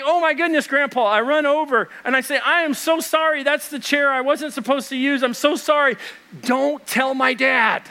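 high, hurried mock apology, slowing at the end; a boy begging forgiveness, half joking; retold panic. Grandson panics after grandpa's fall.

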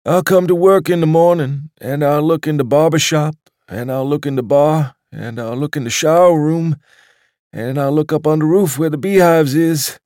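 put-on a doddering old waiter picturing the club without him; old waiter, wistful. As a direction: list each place like a mournful litany. Waiter imagining the empty club.

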